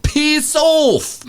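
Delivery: put-on "Piss off" squeaked very high in a jeering British accent; mock British accent. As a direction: jeer it like a snotty Brit. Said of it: "in that stupid accent".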